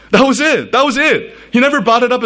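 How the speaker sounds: preacher marveling at his dad's mercy; emphatic. Real, not acted. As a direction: land the point with delight. Preacher stressing the point.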